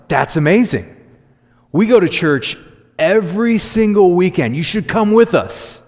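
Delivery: can barely contain the joy; overeager excitement. Retold or imitated. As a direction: invites a friend to church, gushing with excitement. Overjoyed reply to friend's church interest.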